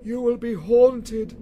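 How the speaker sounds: high, slow, loud moaning spectre; wailing ghost. Acted. Marley's ghost wails at Scrooge, slow and loud. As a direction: drag each word out like a wail from the grave.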